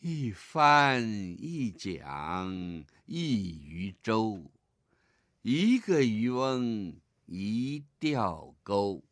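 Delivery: very slow, pausing between images; lead reading again. Shown as a demonstration. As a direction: stretch each image with small pauses. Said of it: "来，再跟我读一遍". (领读，每个形象间稍停)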